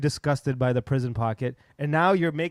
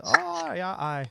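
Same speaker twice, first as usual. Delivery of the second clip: high, hammed-up Irish accent; exaggerated Irish accent demo; 6 semitones higher, much slower than usual. Shown as a demonstration. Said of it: "the way the Irish accent works".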